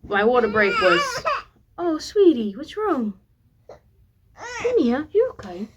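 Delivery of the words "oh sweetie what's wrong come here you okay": high-pitched cooing concern; tender parental comfort. Real, not acted. Parent breaks off to comfort their crying daughter, tender and high. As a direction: soothe a fussing little one.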